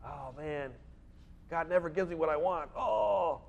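a mumbled grumble that ends in a groaning "Oh"; half-hearted mock grumble, the last "Oh" pushed out; mock complaining. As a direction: whine like a sulky believer. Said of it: "We're gonna complain".

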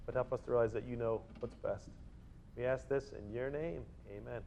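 a quiet, humble prayer voice fading away; closing prayer. Acted. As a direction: pray for the congregation softly.

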